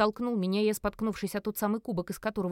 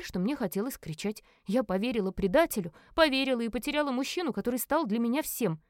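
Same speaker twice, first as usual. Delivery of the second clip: usual speaking voice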